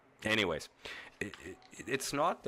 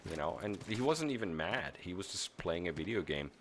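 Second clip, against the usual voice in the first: ordinary talk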